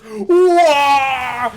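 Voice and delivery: high, loud drawn-out cry; over-the-top cartoon wail; Wario "Wah!" cry. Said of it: "everyone in the household goes".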